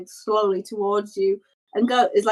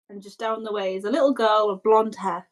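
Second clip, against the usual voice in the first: normal talking voice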